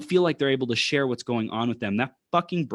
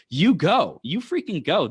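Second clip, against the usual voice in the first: loud, higher cheer of you go; 6 semitones higher, 4 dB louder, slower than usual. Retold or imitated. Cheering on big creators, excited you go. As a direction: egg them on, you go.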